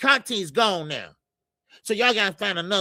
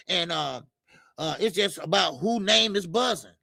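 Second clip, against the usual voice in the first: ordinary talk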